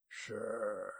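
low purr from the ranger; silky, threatening low voice; purring menace. A narrator's reading. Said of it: "The ranger's voice was a purr".

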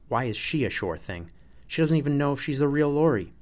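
heated, incredulous objection; indignant objection. A narrator's reading. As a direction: protest it, rising into a loud outburst. Lori two objects to being passed over.

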